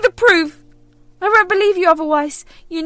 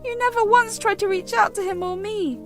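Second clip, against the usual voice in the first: loud, higher, insistent; angry denial; 7 semitones higher, 5 dB louder than usual. Acted. Daughter shouts that her mother is lying, angry. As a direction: refuse to believe her.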